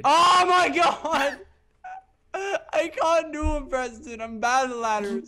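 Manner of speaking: loud, high wail into a whine; despairing whine. Real, not acted. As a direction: wail in defeat over a game. Keeps failing the ladders.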